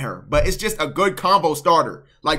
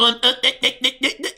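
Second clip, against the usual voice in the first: imitating a stutter, higher-pitched; mock stuttering; 7 semitones higher, faster than usual. Coach mimicking friend's hesitation, mock stuttering. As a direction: stammer one syllable over and over, mocking. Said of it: "you be stuttering over your bottom lip".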